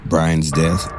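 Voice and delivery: normal talking voice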